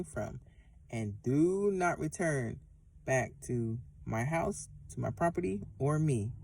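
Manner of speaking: slow, soft, deliberate binding prayer; commanding prayer. Shown as a demonstration. Viewer taught to cast out a demon, slow soft prayer. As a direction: command the spirit out, low and measured. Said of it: "You say".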